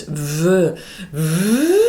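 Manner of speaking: sustained V buzz with glides; buzzing lip exercise. Shown as a demonstration. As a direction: buzz a long V at the lips, sliding in pitch. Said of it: "putting the emphasis at the lips".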